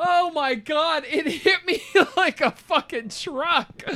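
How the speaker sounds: high, slow laughing exclaim; laughing disbelief. Real, not acted. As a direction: laugh out the shock. Laughing at sudden realization.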